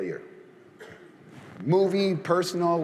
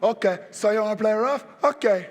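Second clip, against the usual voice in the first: higher, put-on tough guy voice; movie gangster voice; 7 semitones higher than usual. Host mimics a movie tough guy, lightly voiced. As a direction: play rough like a movie heavy.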